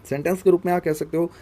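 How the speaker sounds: ordinary talk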